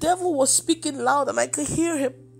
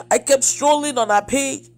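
normal talking voice

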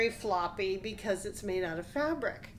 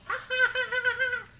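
much higher mock ha ha taunt; mocking laugh; 14 semitones higher, 4 dB louder than usual. Goats laugh at the troll, high and mocking. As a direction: tease the troll in sing-song. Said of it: "they laughed at the troll".

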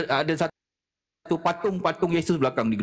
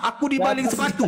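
excited punchline; 9 semitones higher than usual. Real, not acted. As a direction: blurt it out laughing. Punchline of the shoe story.

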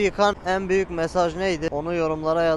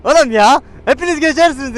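calling out; 9 semitones higher, 7 dB louder than usual. Real, not acted. Repeats call to pedestrians.

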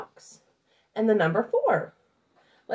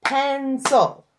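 Pen-cil, loud, two even beats; two loud clapped beats; syllables clapped out; 5 semitones higher, 6 dB louder than usual. Shown as a demonstration. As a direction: clap out each syllable for the class. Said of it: "we're going to do pencil".

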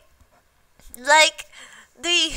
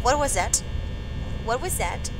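very low, soft, tense murmur; wary hush; 12 semitones lower, 10 dB quieter, faster than usual. Real, not acted. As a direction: check nervously under your breath. Checking for threats in horror game.

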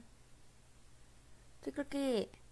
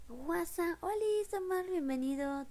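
high kawaii greeting voice; cute anime streamer; 9 semitones higher than usual. Real, not acted. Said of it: "te sale la voz kawaii".

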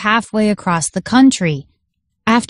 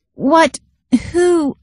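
rising, higher, flustered questions; a startled librarian stammers, much higher; stammered shock; 8 semitones higher than usual. A narrator's reading. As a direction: caught completely off guard. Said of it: "Rick stammered".

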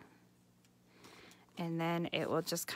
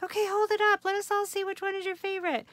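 high, bright-eyed mock teacher voice, louder; imitating the teacher; 12 semitones higher, 5 dB louder, faster than usual. Craft teacher rallying her class, imitated high and eager.

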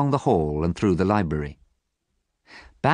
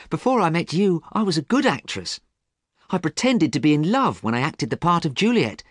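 put-on high, delighted young actress in love; happy lover's high-voiced outpouring; happily; 10 semitones higher, 3 dB louder than usual. A narrator's reading. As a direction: gush with joy, pitched well up. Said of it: "She spoke to him happily".

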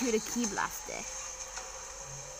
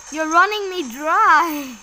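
raised, drawn-out protest; loud complaint; 4 semitones higher, 7 dB louder, slower than usual. Real, not acted. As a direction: stretch the complaint out, high and loud. Complains while being drained in fight.

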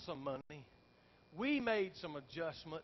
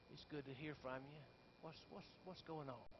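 calm overseer; 2 semitones lower, 11 dB quieter than usual. Retold or imitated. Calm overseer answers phone.

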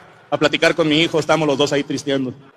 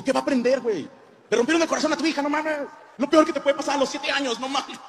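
much higher, indignant but softer; outraged protest; 10 semitones higher, 8 dB quieter than usual. Retold or imitated. Father protesting to wife about daughter's heartbreak, high and indignant. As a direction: protest in pitched-up disbelief.

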